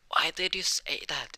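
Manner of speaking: high, drawn-out flustered question; embarrassed protest. Acted. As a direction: act mortified, pitch shooting up. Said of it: "red in the face".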